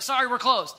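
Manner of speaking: loud quick brush-off; hurried dismissal. Retold or imitated. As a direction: shut them down fast and loud. Innkeeper turning people away.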